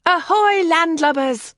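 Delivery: high, loud playful hail; cheerful call. Acted. Pandora on the lawn greeting the visitors, loud and cheerful. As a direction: sing out a pirate greeting. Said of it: "she called out cheerfully".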